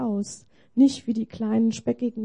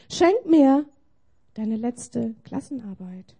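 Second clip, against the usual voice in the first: usual speaking voice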